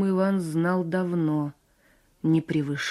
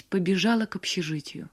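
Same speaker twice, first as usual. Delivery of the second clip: plain reading voice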